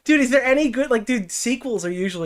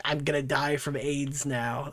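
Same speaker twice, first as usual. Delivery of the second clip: slow, softer, lower deadpan; mock dying man; 4 semitones lower, 7 dB quieter, slower than usual. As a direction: flatly announce your own death, unbothered. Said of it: "He's like".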